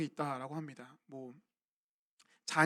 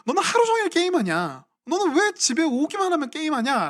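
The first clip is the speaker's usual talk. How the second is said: higher-pitched scolding parent, a bit louder; nagging parent; 8 semitones higher, 3 dB louder than usual. Retold or imitated. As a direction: mimic a fed-up mom nagging her gamer kid. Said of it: "부모님들이 이런 말을 합니다".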